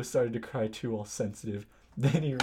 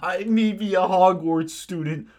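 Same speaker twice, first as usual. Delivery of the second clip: louder, higher indignant retort; angry; 5 semitones higher, 8 dB louder than usual. Acted. Hagrid answers being called a student, hammed-up anger. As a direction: fire it back loudly, hammed-up anger. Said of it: "Hagrid paused angrily".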